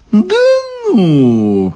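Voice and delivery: drawn-out, falling amazed exclamation; pleasantly amazed. A narrator's reading. Daughter hears Ivan is roasting, pleasantly amazed. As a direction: punch it, then let it slide down. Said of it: "приятно изумилась дочка".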